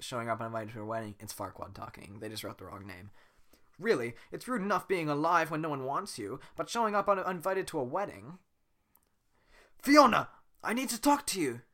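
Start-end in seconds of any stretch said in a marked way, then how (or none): 3.8-8.4 Farquaad voice. Acted. Reread as Farquaad. 9.8-11.6 calling out. Acted. Shrek calls out to Fiona.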